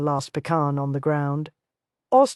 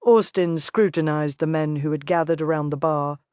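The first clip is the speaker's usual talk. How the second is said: ordinary delivery, nothing marked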